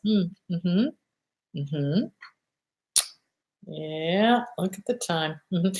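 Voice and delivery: demo gray rock. Shown as a demonstration. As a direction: show the disengaged, flat hum-along. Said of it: "And you do this".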